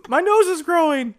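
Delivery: high loud puppet voice; Pinocchio voice. Acted. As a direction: squeal it like a wooden boy. Voicing Pinocchio.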